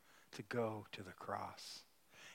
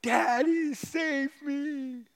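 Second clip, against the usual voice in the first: put-on much higher, much louder desperate child's cry; 12 dB louder, much slower than usual. Daughter begging her father during the gravel removal, desperate high cry. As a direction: cry out like a terrified little girl. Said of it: "she cried out from the top of her voice".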